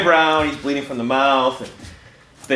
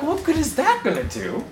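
higher, disbelieving aside; incredulous; 9 semitones higher, 6 dB quieter than usual. Retold or imitated. Passenger watching the drunk roll a cigarette, incredulous. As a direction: scoff at the smuggler in mock disbelief. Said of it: "I'm like".

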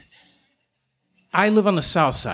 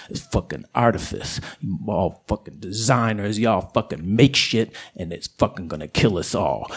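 a much lower, gruff streetwise voice; south side coyote; 10 semitones lower than usual. Acted. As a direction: sneer it down low like a tough coyote. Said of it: "south side coyote".

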